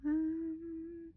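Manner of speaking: high, soft mock-hesitant um; a receptionist's high, soft, unsure stalling hum. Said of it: "she's gone sort of like".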